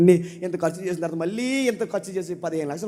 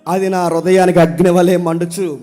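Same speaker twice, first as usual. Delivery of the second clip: loud, impassioned pulpit preaching; full-force preaching; 6 semitones higher, 13 dB louder than usual. Acted. Preacher pleading loudly to his congregation, impassioned. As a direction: thunder the prophet's complaint at the congregation.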